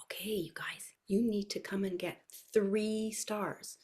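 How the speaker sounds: hushed, slow teacher instruction; slow, breath-soft preschool voice; talking to toddlers. Shown as a demonstration. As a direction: spell it out softly for very young children. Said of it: "I'm pretending that I'm talking to".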